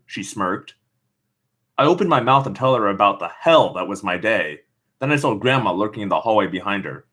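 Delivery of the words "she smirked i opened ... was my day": plain reading voice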